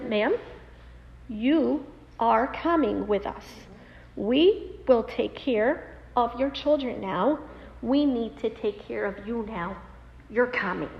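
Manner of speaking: slow, deliberate word-by-word order; firm, as to a toddler. Retold or imitated. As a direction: imitate paramedic laying down the law. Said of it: "like you would look at a two-year-old".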